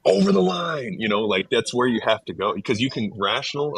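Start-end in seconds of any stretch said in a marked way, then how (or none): 0.1-1.0 mock movie yell, pitched up high; Big Lebowski shout. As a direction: bark it like the bowling-alley rant. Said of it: "You've seen Big Lebowski?".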